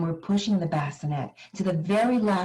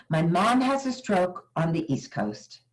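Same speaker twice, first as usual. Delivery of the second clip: normal talking voice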